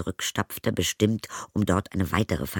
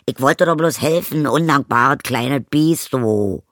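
higher, loud indignant yelling; furious yell; 6 semitones higher, 9 dB louder than usual. Acted. Stepmother yells at ungrateful Zoe, loud. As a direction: yell back, offended.

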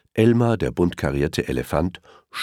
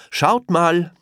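higher, louder call for attention; Elmar calling out; 13 semitones higher, 5 dB louder than usual. A narrator's reading. Elmar calls to the crocodiles, eager call. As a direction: get them to look. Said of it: "rief Elmar".

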